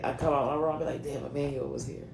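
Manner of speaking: ordinary talk, nothing marked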